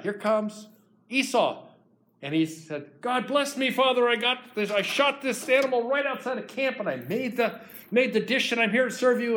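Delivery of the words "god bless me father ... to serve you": higher, bursting with pride; proud eager son. Acted. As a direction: present the dish full of pride. Esau proudly returns with game.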